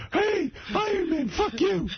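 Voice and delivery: high, slow jeering heckle at the screen; mock heckler. Mocking a movie hero from the audience, high jeering yell.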